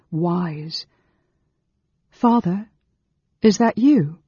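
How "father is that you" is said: put-on higher, louder, young man calling out uncertainly; a son's tentative call to his father; hopeful son calling. A narrator's reading. Son Gilthas calls to his father across the path, higher and hopeful. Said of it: "the word was repeated".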